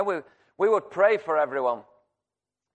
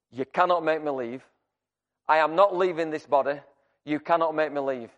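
usual speaking voice

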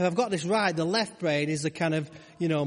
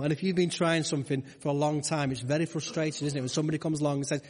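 normal talking voice

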